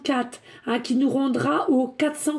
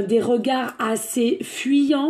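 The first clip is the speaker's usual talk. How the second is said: usual speaking voice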